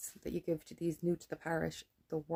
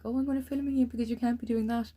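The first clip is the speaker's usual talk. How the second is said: high, louder sneering impression; mock him as a self-important fool; mocking the filming friend; 7 semitones higher, 5 dB louder than usual. Mimicking the passenger filming her, high mocking voice. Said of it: "as if like".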